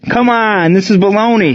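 high-pitched, indignant protest; mad yell. A narrator's reading. Batter protests an intentional walk, mad yell. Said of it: "he yelled".

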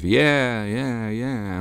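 cheering yeahs from far off, dying away; distant crowd cries. A narrator's reading. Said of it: "intermittent cries of".

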